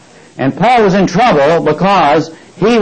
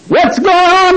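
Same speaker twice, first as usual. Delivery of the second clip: much higher-pitched calling out; acted alarmed demand; 14 semitones higher, slower than usual. Roman soldiers walking in on a fight, acted high-pitched demand. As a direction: break in on a brawl, voice pitched high.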